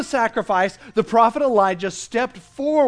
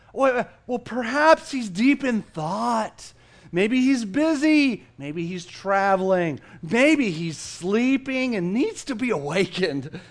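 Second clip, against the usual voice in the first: taunting; 6 semitones higher, 4 dB louder, slower than usual. A narrator's reading. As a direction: tease them with drawn-out mock speculation. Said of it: "began to taunt them".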